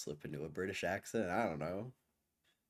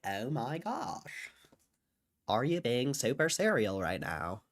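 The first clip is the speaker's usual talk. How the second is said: put-on femboy lilt, swooping upward; drawn-out, affected femboy gushing; 3 semitones higher than usual. Shown as a demonstration. Streamer showing chat their femboy voice, affected and lilting. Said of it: "femboy voice".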